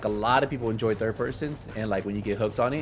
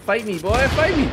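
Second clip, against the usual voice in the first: high, loud trash-talk yell; taunting shout; 13 semitones higher, 10 dB louder than usual. Real, not acted. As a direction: challenge them, getting louder. Taunting opponent.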